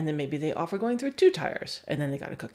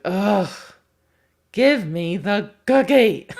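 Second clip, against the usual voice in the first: loud whiny laughing plea, slowed down; whiny dog voice; 4 semitones higher, 10 dB louder, slower than usual. Retold or imitated. Trainer voicing a dog whining for its cookie, loud and laughing. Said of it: "It's like".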